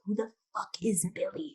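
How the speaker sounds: hushed, unvoiced whisper; a quick baffled whisper. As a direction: breathe it out like a confused aside. Character's whispered retort.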